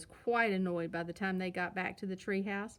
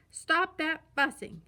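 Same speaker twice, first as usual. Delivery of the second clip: louder, slow booming command of a father; loudest papa bear voice; 6 semitones higher, 7 dB louder, slower than usual. A narrator's reading. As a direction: put an end to the fuss with a big voice. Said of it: "in his loudest Papa Bear voice".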